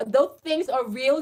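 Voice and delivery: normal talking voice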